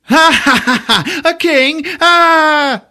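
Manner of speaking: loud, high scornful cackle of laughter into a sneering A king?; mocking laughter. Prisoner leader laughing at Colwyn's king, high and scornful.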